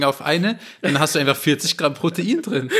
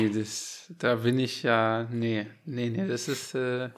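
hesitant and quiet; 6 semitones lower, 9 dB quieter, slower than usual. Real, not acted. Hesitant disagreeing.

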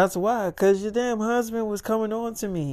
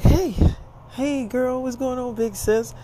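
high, lilting hello; high-pitched girly greeting, acted; sisterly phone greeting; 6 semitones higher than usual. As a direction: greet big sis in a raised, playful pitch. Sinitra greets her sister by phone.

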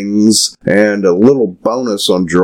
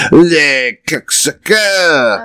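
slow, much higher hammed-up French accent; bad French accent; 9 semitones higher, slower than usual. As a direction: ham up a fake French accent. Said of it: "me doing a bad French accent".

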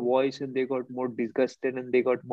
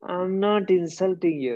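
mocking high retort; 5 semitones higher, slower than usual. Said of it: "I said".

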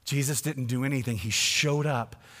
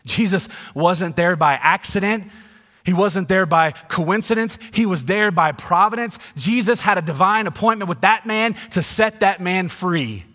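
loud, high proclamation; full-force preaching; 5 semitones higher, 8 dB louder than usual. Acted. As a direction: drive it home to the congregation.

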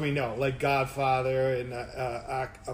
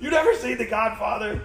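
higher, incredulous retold question; worked-up disbelief; 6 semitones higher, 4 dB louder than usual. Retelling friend's shock about The Godfather, incredulous. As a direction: ask it as if scandalized, playfully. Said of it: "worked up over Godfather references".

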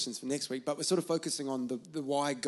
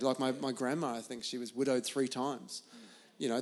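ordinary delivery

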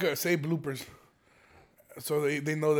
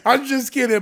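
high, loud, still cracking up; laughing; 6 semitones higher, 9 dB louder than usual. Real, not acted. Host taking back his joke about the buyers, laughing. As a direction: get it out through the tail of a laugh.

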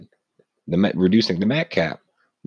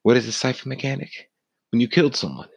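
higher, fast announcer-like question; odd voice; 8 semitones higher, faster than usual. Real, not acted. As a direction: hype it up high and quick. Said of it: "I don't know why I'm talking like this".

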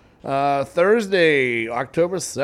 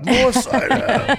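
high, loud, slow and laughing; greedy pirate voice; 10 semitones higher, 5 dB louder, much slower than usual. Retold or imitated. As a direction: beg for more cider like a drunk pirate. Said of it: "Ken was just, like".

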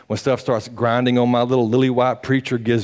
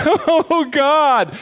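high, loud, breathless cry of awe; imitating a camper overwhelmed by God; 4 dB louder than usual. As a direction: burst out like a kid overcome in worship. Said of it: "like that".

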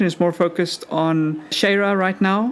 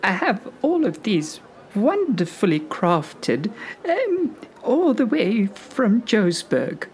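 higher sweet granny voice; very old woman; 5 semitones higher than usual. Acted. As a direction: fawn over the customer like a granny. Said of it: "a very old lady".

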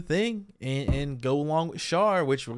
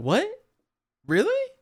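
high, disbelieving "Really?"; shocked disbelief at a sibling leaving; incredulous; 4 dB louder than usual. Retold or imitated. Mock reaction to Char leaving.

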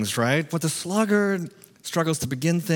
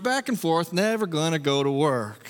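ordinary talk, nothing marked

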